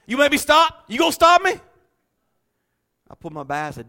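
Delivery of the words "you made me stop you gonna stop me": high, loud, confrontational retort; defiant challenge. Retold or imitated. Patient squaring up to the guard, loud defiant challenge. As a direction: throw it back at him, spoiling for a fight.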